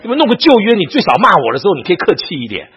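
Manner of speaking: loud, high, pleading complaint; exasperated inner retort. Retold or imitated. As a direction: argue back heatedly, as if to the teacher. Acting his exasperated inner retort.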